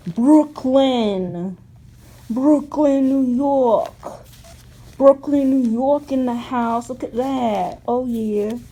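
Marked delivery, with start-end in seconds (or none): 0.1-6.9 hammed-up Brooklyn accent, loud; bad Brooklyn accent, playful. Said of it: "That's a horrible Brooklyn accent".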